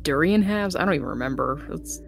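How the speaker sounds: usual speaking voice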